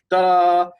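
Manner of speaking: a sudden high, loud "Da"; a short punchy syllable demonstrating the mark; mock accent sound. Shown as a demonstration. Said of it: "a backward-faced capital L going like".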